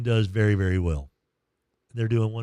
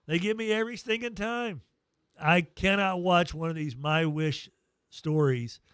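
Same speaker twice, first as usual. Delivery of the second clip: usual speaking voice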